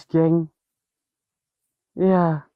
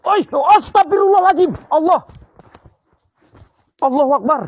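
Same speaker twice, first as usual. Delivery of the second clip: high, loud panicked exclamations; startled cry; 12 semitones higher, 5 dB louder than usual. Real, not acted. Ghost hunter startled by something falling, high panicked cries. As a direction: jolt as something drops from above.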